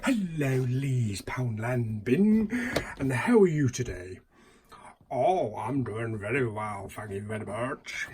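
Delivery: put-on a goofy American Southern accent answering the bin; weird American Southern accent. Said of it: "Why have you got a weird American Southern accent?".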